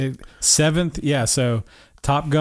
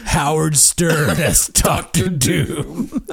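slow laughing trailer announcer; half-laughing trailer narration; movie trailer voice; slower than usual. Pretend. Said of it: "I want the voice again".

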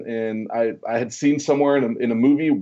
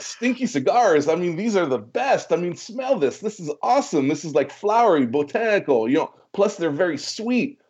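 higher, pushy disbelief at the insult; a young rep defending his cigars, pitched up; young salesman pitches his sweet cigars to the shop owner, eager and higher; indignant eager rookie; 5 semitones higher than usual. Retold or imitated. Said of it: "I'm like".

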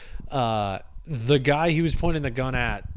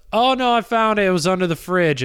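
higher and louder, a sudden oh-no; lady's surprised voice; 6 semitones higher, 5 dB louder, faster than usual. Retold or imitated. Woman telling the man the missing thing was under the fridge, high and loud. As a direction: play the woman who just found it.

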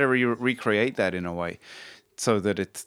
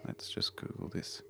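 whispered aside to himself; 17 dB quieter than usual. Real, not acted. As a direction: breathe it under your breath. Muttering to himself while searching.

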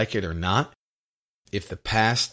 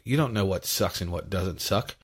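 usual speaking voice

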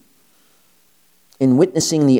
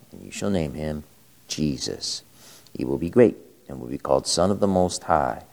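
lower, solemn liturgical reading; Gospel reading; 3 semitones lower than usual. Acted. Priest reads the Gospel aloud.

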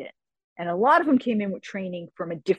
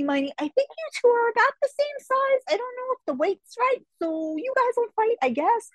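a high, breezy official voice, much higher; mock official; 13 semitones higher than usual. Speaker mimicking a tournament official assigning her fights, high and breezy. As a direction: play the casual official shrugging it off.

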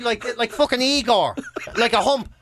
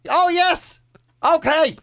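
high, mildly louder vague agreeing; light deaf neighbour voice; 9 semitones higher, 3 dB louder, much slower than usual. Retold or imitated. Deaf neighbour answering the mother, lightly voiced old man. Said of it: "he was like".

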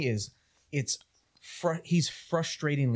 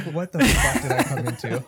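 mock bewildered; 6 dB louder than usual. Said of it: "He's like".

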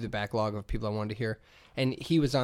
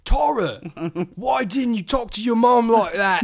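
high, loud mocking British voice calling Tara; mock British Jax; 9 semitones higher, 7 dB louder, slower than usual. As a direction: yell his wife's name in a hammed-up British accent. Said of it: "I'm like".

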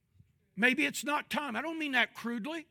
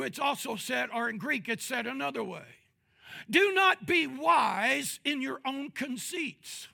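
normal talking voice

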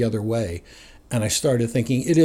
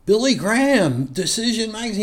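surprised; 9 semitones higher than usual. Retold or imitated. Friend finding the magazine, reading its title in surprise. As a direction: hold it up and read it out, amazed.

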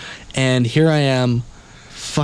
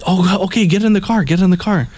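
pushy driver; 8 semitones higher than usual. Retold or imitated.

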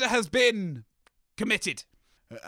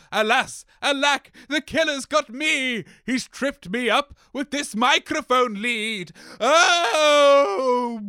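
loud, high melodramatic cry; hammy death cry; 4 semitones higher, 4 dB louder than usual. Acted. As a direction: overplay being killed. Constable killed in play.

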